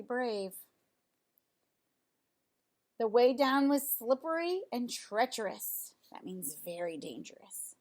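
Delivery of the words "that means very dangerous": hushed aside to the listener; whispered aside. Real, not acted. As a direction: lean in and whisper the meaning. Whispered aside explaining a word.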